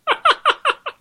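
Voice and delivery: big squealing burst of laughter; loud high laugh. Real, not acted. Laughing at end of story.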